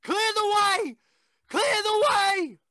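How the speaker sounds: screaming warning. Acted. Voice warning the man as the train comes, full scream.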